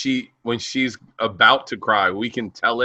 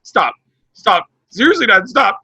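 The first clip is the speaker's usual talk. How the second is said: higher, louder imitated child's protest, building; upset daughter protesting; 7 semitones higher, 6 dB louder than usual. Daughter begging dad to stop as tears near, mock-upset. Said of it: "She's like".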